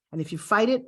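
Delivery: ordinary delivery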